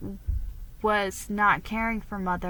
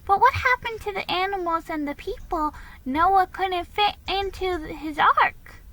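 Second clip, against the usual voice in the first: put-on high, curious child asking a question; a young girl asking about Noah; little girl voice; 10 semitones higher, 4 dB louder than usual. A narrator's reading. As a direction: pitch it up like a small girl puzzling. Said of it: "said Nan".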